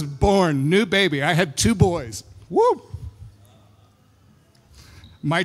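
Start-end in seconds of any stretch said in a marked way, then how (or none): 2.5-2.8 high-pitched "woo"; quick celebratory whoop. Real, not acted. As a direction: let out a short high whoop. Cheers after mentioning two boys.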